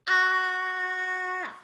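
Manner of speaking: high, loud "Ah," held on one even pitch; level first tone. Shown as a demonstration. Teacher demonstrates the first tone, a level high "Ah,". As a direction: hold "Ah," straight as a line, high and loud. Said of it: "imagine a straight line".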